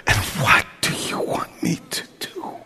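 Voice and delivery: hushed, breathless whisper; whispered prayer. Shown as a demonstration. Pastor modelling the prayer as a soft whisper. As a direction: whisper it to God alone. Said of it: "The greatest prayer you can pray right now is".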